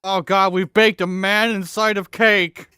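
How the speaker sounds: slow, high, hammed-up cry of horror; slow mock-horrified cry about the cake. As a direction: wail it like a hammed-up horror scene.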